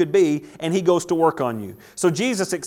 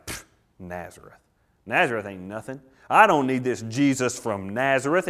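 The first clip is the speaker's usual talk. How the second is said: scornful; 5 semitones lower than usual. Acted. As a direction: brush it off with contempt. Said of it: "he's just sitting here like".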